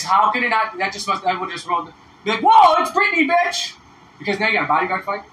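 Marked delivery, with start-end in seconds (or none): 2.4-3.7 high, loud impersonated whoop of recognition; mock excited exclamation. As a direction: act out a starstruck shout. Acting bodyguard's missed exclamation.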